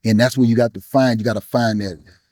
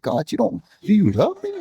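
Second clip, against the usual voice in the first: high, pleading question to God; 8 semitones higher than usual. Retold or imitated. Retelling his grief, asks God whether he loves him, high and pleading. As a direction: ask God if he still cares, voice lifted high.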